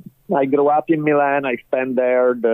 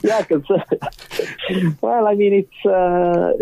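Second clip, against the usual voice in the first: laughing; 8 semitones higher than usual. Real, not acted. Guest laughs at compliment.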